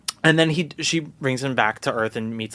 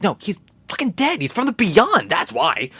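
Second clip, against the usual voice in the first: exasperated, much higher; 9 semitones higher than usual. Real, not acted. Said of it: "exasperated rant".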